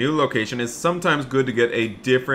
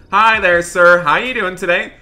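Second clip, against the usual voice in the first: cheery greeting; 6 semitones higher, 6 dB louder than usual. Pretend. Deputy orders at burger counter.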